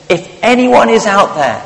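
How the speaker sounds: high, loud desperate cry for help; desperate plea. Acted. Man hanging from a branch begs the sky, high desperate cry. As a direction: plead upward like a man dangling from a cliff. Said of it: "in his desperation".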